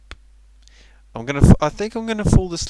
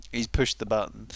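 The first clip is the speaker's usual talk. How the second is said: ordinary talk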